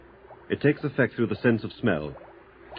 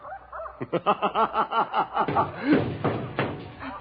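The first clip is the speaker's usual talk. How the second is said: high, breaking hysterical laugh; mad laughter; 11 semitones higher than usual. Acted. Trapped in his own cage, a high unhinged laugh. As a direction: laugh like your mind is cracking.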